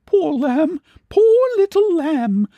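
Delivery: put-on high tender old aunt's voice; old aunt soothing a sobbing girl. A narrator's reading. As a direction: comfort a crying girl.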